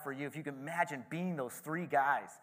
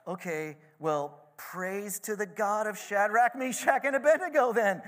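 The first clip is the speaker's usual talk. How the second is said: builds from hesitant to a much higher, louder praise; amazed king; 8 semitones higher, 7 dB louder than usual. Retold or imitated. King praising the God of the three, mounting amazement. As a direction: start unsure, then burst into praise. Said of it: "He's amazed".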